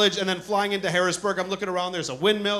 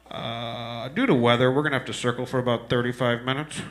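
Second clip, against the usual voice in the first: pilot voice; 7 semitones lower than usual. Retold or imitated. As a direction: talk it down like a bored captain. Pilot announcement over intercom.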